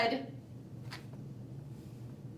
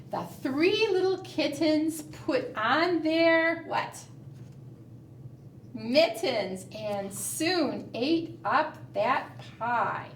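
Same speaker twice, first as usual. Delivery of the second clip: usual speaking voice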